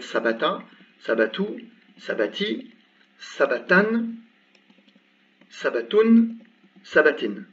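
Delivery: sabata, sabatu, sabati, sabatan, sabatun, sabatin, endings clearly voiced; case endings demo. Shown as a demonstration. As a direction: sound out every case ending, rising on the nunation. (déclinaison sabata à sabatin bien articulée)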